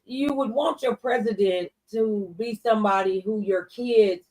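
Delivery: ordinary talk, nothing marked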